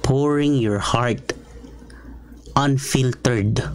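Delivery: "pouring" drawn out slowly; slow emphasis. Shown as a demonstration. Pastor describing honest prayer, slow and weighty. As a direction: stretch out "pouring".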